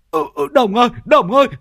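very high, stammering panicked cries; panicked yelling. Acted. Passenger begs driver to brake before killing someone, panicked. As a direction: scream at the driver to brake, tripping over words.

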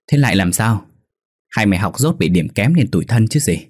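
usual speaking voice

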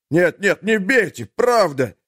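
high frightened begging; panicked pleading. A narrator's reading.

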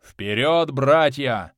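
loud commanding battle cry; roaring rallying speech. A narrator's reading. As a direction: lead the charge with a roar. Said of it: "скомандовал Борх Златорукий".

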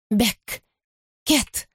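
creepy playful under bed whisper. Acted. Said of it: "шёпот из-под кровати".

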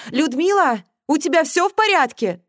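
high, loud call from downstairs; calling upstairs. A narrator's reading. Mother calls up from the first floor, calling out. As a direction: call out to check on someone. С первого этажа донёсся голос.

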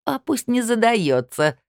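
high, slow, self-satisfied retort; pleased chuckling tone. A narrator's reading. Colleague gloating after routing her rival, pleased high drawl. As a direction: gloat lightly, drawing the words out high. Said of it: "довольно хохотнула Рыбина".

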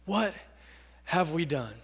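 low, soft, halting regret; deep sorrow. Acted. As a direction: let it sink out quietly after a pause. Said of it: "With deep sorrow".

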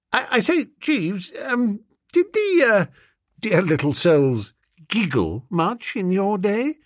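high, stammering upper-class master; hesitant, embarrassed gentleman. Acted. Master nervously asking his valet about giggling schoolgirls, hesitant. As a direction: stumble into the question, pitched well up.

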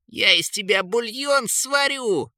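high, slow, outraged threat; furious threat. A narrator's reading. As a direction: stretch the threat out in a high, angry voice. Kane threatens the eagle. (гневная угроза высоким голосом)

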